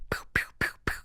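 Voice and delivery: voiceless little gunshot pops; whispered pew noises. Retold or imitated. Storyteller miming shots at the man's message, whispered pews. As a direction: fire off tiny whispered shots. Mimes firing back after his like.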